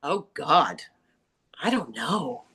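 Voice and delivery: ordinary delivery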